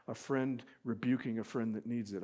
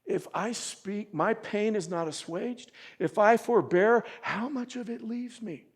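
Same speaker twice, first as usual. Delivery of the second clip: Job's anguished plea; 7 semitones higher, 8 dB louder than usual. Retold or imitated. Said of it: "he says".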